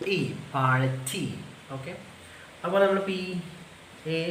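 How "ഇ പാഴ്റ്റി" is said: പാഴ്റ്റി drawn out slowly as party, no r; slow sound-by-sound demo. Shown as a demonstration. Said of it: "അത് നമ്മൾ transcribe ചെയ്യുമ്പോൾ". (ഓരോ സൗണ്ടും പതുക്കെ പാഴ്റ്റി)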